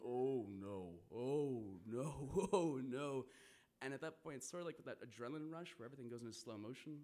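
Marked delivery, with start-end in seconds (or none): 0.0-3.3 drawn-out, building "oh no"; mounting dread, getting louder each time. Retold or imitated. Traveler watching the dog get closer and closer, mounting dread. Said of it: "I'm thinking".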